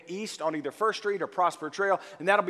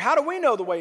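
higher, louder imitated question; questioning disciple; 7 semitones higher, 6 dB louder, much faster than usual. Thomas questions Jesus about the way, higher and louder imitated question. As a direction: lightly play a puzzled questioner. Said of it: "Thomas is like".

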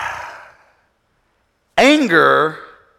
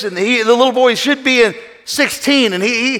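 ordinary delivery

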